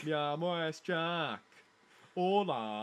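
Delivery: slow, put-on Spanish voice; Spanish accent. Friend trying out a Spanish accent, slow mock Spanish. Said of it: "don't know what Spanish accent".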